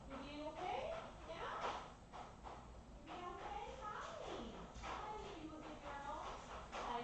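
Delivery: high, soft sing-song pet talk; soft high voice soothing a dog. Real, not acted. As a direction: coo gently at the puppy. Baby talk to the puppy.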